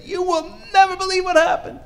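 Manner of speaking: very high, loud excited squeal; breathless-news excitement. Acted. Students running back to their rabbi, wildly excited. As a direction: burst out with incredible news.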